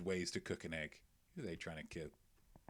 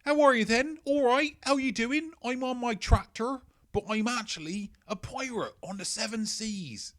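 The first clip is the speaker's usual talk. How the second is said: much higher, louder squeaky hobbit pirate voice; hobbit-style pirate; 12 semitones higher, 9 dB louder than usual. Retold or imitated. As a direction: play a cheery little hobbit who is secretly a pirate. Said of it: "those little fellas from the Lord of the Rings".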